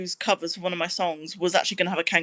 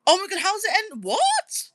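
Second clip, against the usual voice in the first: shocked disbelief; 13 semitones higher than usual. Real, not acted. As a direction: gasp at news you can't believe. Reacts to Neighbours ending.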